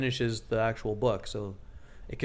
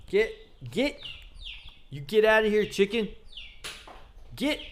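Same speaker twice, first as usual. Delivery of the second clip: higher shout to shoo chickens away; 6 semitones higher than usual. Real, not acted. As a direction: chase the chickens out. Shooing chickens out of house.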